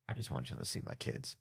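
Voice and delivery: hushed aside while filming; whisper. Real, not acted. Father filming his kids, whispering.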